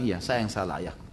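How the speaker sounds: soft, low confession; meek admission. Retold or imitated. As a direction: own up quietly to your father. Child admits fault to his father.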